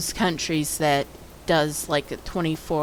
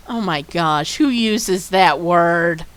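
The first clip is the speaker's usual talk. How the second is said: ordinary talk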